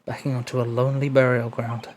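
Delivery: soft, even narrator read; story narration. Acted. Narrator describes the lonely prison walls, softly told. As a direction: set the foggy prison scene calmly.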